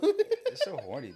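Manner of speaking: very high, slow, laughing; laughing teasing squeal; laughing tease. Real, not acted. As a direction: squeal it while cracking up.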